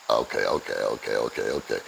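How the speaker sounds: deep, quiet, to himself; low mutter. Retold or imitated. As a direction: murmur it under your breath. Clerk muttering while checking bags.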